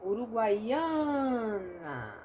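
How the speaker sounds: Uruguaiana stretched very slowly; drawn-out teaching read of Uruguaiana; slowly spelled out. Shown as a demonstration. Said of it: "vamos lá".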